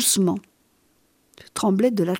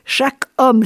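louder anguished outcry on homme; the creature cries out, louder, wounded and furious; cry out; 4 semitones higher, 6 dB louder than usual. A narrator's reading. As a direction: fling the words out in bitter despair. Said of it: "s'écria-t-il".

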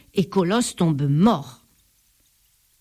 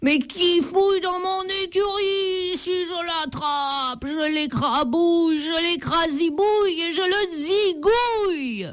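booming giant voice; 9 semitones higher than usual. A narrator's reading. The giant Colosse roars at whoever is in his stable, menacing. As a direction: roar at the thief in the stable. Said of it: "La voix du géant retentit".

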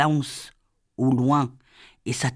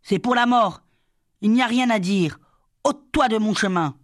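higher-pitched, forceful defiance; heated character voice in narration; angry threat; 6 semitones higher than usual. A narrator's reading. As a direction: order them out of the way, pitched up. Brother's threat in acted quarrel.

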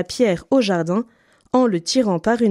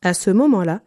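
ordinary delivery, nothing marked

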